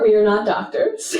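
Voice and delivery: ordinary delivery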